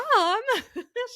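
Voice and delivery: short, high rising laugh. Real, not acted. Laughing at mom's reply, high giggle.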